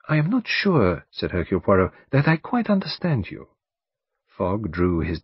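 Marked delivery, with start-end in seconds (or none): 0.1-1.1 higher-pitched, polite detective voice; courteous detective. Acted. 2.1-3.5 higher-pitched, polite detective voice; courteous detective. Acted.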